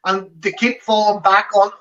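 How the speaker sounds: normal talking voice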